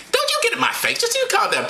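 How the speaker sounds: high falsetto telling-off; comic high-pitched woman; confrontational Mary. Acted. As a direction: back them off in a very high voice. Acting Mary confronting the wise men.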